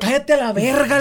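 laughing mock rebuke, high; mock snap. Retelling telling the roommate to shut up. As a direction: snap it at him half laughing. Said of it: "le dije, güey".

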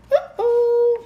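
very high guilty squeak; squeaky uh-oh. Acted. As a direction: caught out. Pigeon caught.

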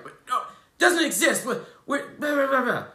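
high, loud, whiny mock sceptic. Mocking people who reject talk of spirit, high whiny parody. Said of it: "It just triggers them".

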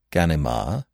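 ordinary delivery, nothing marked

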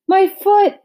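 shrill yelp of pain; pained shout. A narrator's reading. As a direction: cry out at the hurt foot. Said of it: "shouted the bee".

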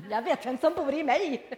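skrattande kaxig. Retold or imitated. Laughs while quoting herself.

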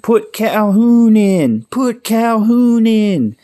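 high, drawn-out crowd yell; screaming fan. Retold or imitated. A fan in the stands starts yelling for Calhoun, high drawn-out scream. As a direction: imitate a stadium fan screaming for a player. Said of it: "one of the fans starts screaming".

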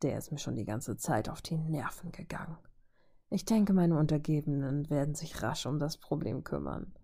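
a dismissive menacing hush; cold whisper. Acted. Ultron crushes the receiver coldly.

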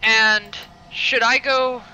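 panting after the fight; out of breath. Acted. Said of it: "I'm visibly out of breath".

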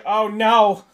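sudden loud comic alarm; mock panic. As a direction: blurt it out as if disaster struck. Thinks he has wet himself.

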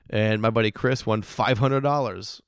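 ordinary talk

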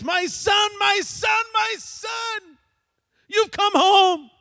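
high, loud cries of a weeping father; overjoyed father. Acted. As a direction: cry it out, hugging him tight. Father weeping, embracing son.

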